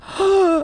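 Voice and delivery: a sharp high gasp of shock; mock shocked gasp. Finding her lunch thrown out, a high mock gasp. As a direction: gasp as if you just found it gone.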